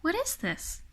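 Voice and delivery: put-on high, soft child's question; wondering small girl voice; curious little girl. A narrator's reading. Lucy sees a new candy.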